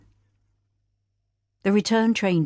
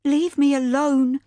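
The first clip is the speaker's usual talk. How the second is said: higher, slow pained plea; grieving widow; 8 semitones higher, 4 dB louder, slower than usual. Acted. Grieving widow to her daughter, higher slow pained voice. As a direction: push them away, hurting. Widow pushing family away.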